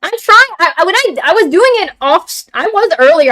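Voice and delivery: high, loud, stumbling excuses; flustered apology. Real, not acted. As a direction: laugh it off while explaining. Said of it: "my accents are not coming out".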